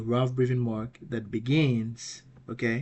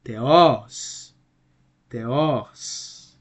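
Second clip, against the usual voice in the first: "theos" with the second syllable raised and drawn out; stress on final syllable; 8 dB louder than usual. Shown as a demonstration. Teacher showing students where the accent falls, "theos" stressed on the o. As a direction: model "theos", leaning hard on the o. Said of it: "acute accent over the omicron".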